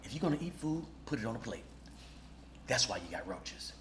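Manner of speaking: low, quiet deadpan lecture; low murmur, matter-of-fact; exterminator. Retold or imitated. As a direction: calmly tell them off. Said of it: "I said".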